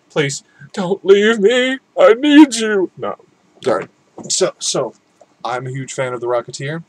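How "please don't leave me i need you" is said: high, loud, over-the-top begging; mock desperate plea. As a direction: wail it like a clingy lover. Begging viewers not to leave.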